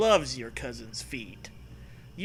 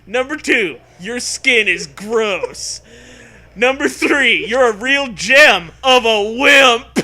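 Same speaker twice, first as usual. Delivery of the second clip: much higher, louder mock announcer; hammed-up loud dog announcer; 7 semitones higher, 12 dB louder, slower than usual. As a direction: read the list like a game-show host. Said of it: "the dog begins".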